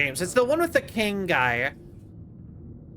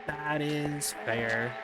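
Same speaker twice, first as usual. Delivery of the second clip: slow, lower, softer "That is fair." in a put-on voice; low mock voice; 7 semitones lower, 8 dB quieter, much slower than usual. Gamer echoing "That is fair." to chat in a low, slow put-on voice. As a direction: drop "That is fair." low and quiet, stretched out. Repeating a line twice differently.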